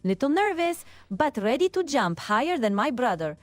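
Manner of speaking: cartoon Italian plumber accent, lively pitch swings; nervous but game little plumber with Italian accent; Luigi impression. Co-host performing an impression on a viewer's request, cartoon Italian accent. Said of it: "do Luigi accent".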